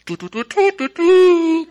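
high, loud sung "tu-tu" trumpet call; mock fanfare. Preacher mocking fanfare for a thanked brother, high and loud. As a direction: toot out a grand fanfare.

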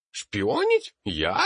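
shrill, loud, high offended squeal; indignant professor. A narrator's reading. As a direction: play wounded innocence, loud and high. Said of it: "стал в позу невинно оскорблённого".

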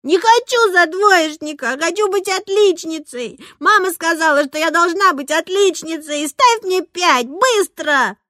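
high, loud demanding outburst; shrill, insistent little girl ordering the teacher; shouting child's tantrum. A narrator's reading. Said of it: "закричала она".

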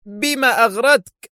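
slow, high-pitched angry shout; roaring shout. A narrator's reading. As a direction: roar the question, drawing it out. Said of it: "قاطعه ديليشمسكي بصيحة هادرة".

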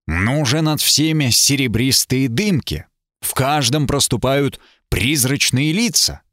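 plain reading voice

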